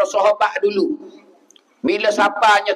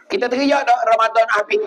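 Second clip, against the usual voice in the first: ordinary delivery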